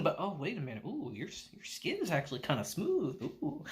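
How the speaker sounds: hushed creepy admiration; creepy hushed ooh. Retold or imitated. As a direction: murmur it like a creep noticing smooth skin. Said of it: "Uncle's like".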